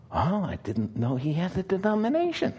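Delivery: put-on the German lady, puzzled, in a German accent; soft, surprised German accent; mock German accent. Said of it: "You like my accents?".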